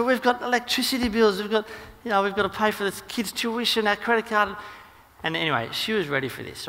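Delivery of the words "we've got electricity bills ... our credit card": high-pitched hammed-up husband complaining; indignant protest. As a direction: rattle off money worries in mock indignation. Husband argues over household money.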